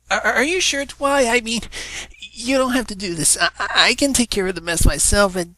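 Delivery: put-on high, stammering young dragon voice; worried little assistant pleading; nervous Spike. Spike nervously offering to clean the mess.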